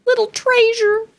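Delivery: very high-pitched, doting coo; tiny high cutesy voice; cutesy endearment. Real, not acted. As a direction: coo it high, as if to someone adorable.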